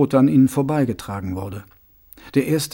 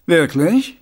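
mock surprise; 4 semitones higher, 5 dB louder than usual. A narrator's reading. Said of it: "erwiderte Mumm".

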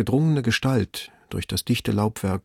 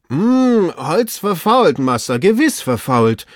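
high, slow, drawn-out report; hum, then call the bad news down; servant's loud, reluctant judgement; doubtful servant; 7 semitones higher, 5 dB louder, slower than usual.

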